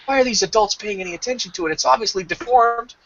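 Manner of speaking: high, put-on snooty cat voice complaining about the baby; disgusted cat voice. Cat sneering at the family's new baby, high mock disgust.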